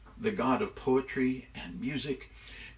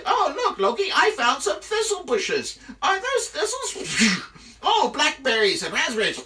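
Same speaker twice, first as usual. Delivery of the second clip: high, loud, gleeful taunt; mock delight; 9 dB louder than usual. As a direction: feign cheerful discovery to torment someone. Eagle gleefully picks next torment.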